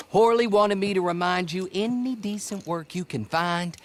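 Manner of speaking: usual speaking voice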